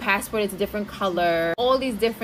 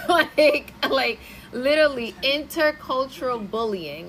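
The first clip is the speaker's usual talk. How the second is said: laughing; 4 semitones higher than usual. Real, not acted. Laughing exasperated reaction.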